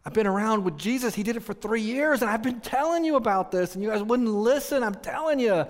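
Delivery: high, urgent insistence; insistent testifying. Retold or imitated. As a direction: press the point on people who wouldn't listen. Voices Peter insisting to doubters.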